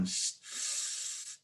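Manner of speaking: long, soft "shhh"; drawn-out hissed shush; restraining shush. Shown as a demonstration. Demo of holding back loud talk.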